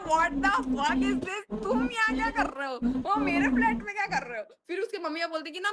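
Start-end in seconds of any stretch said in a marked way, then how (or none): 0.0-4.5 raised pitch, retold indignant disbelief; shocked confrontation. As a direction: act out demanding an explanation. Retelling confronting parents in her flat. (हैरानी भरी नाराज़गी की नकल)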